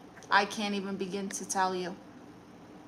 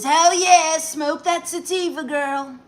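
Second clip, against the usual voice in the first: higher, loud, cheering mock voice; put-on Trump voice; 6 semitones higher, 6 dB louder, slower than usual. Reads a chat message from "President Donald Trump" in a cheering mock voice. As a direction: hype it up like a goofy cheerleader.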